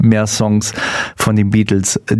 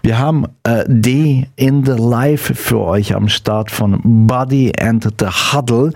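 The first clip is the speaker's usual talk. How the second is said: ordinary delivery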